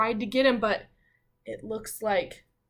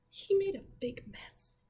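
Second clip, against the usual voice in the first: whispered aside; 10 semitones higher, 4 dB quieter than usual. Real, not acted. Teacher whispering to the class about the wolf's mess. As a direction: let them in on a joke. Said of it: "aside to children".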